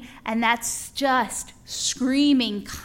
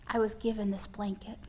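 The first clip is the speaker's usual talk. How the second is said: hushed; 9 dB quieter than usual. Real, not acted. Personal story of comfort, hushed.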